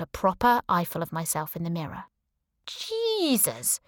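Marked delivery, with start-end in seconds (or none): 2.7-3.8 high shocked yelp of a Jesus; shocked exclamation. A narrator's reading. Heroine seeing her wrecked reflection, shocked yelp. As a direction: gasp it out at the mirror.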